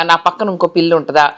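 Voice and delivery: low, grudging jealous voice; jealous cat. Acted. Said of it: "చూడు ఎంత jealous గా ఉందో".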